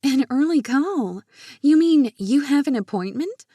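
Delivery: high, loud astonished question; wide-eyed surprise. A narrator's reading. Lydia questioning Wade's early appointment, high and startled. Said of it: "Her eyes widened".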